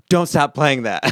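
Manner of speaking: retold yell. Artist yelling at producer in the studio, retold yell. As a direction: call it out urgently, as if across the studio. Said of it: "I looked at him and yelled at him".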